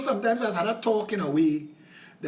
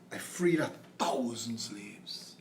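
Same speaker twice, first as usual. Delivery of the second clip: soft, worn-out whisper; exhausted whisper; 10 semitones lower, 10 dB quieter, slower than usual. Retold or imitated. Harriet, exhausted, confides in a whisper. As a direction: whisper it, drained and tired. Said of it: "in her exhaustion, one day said".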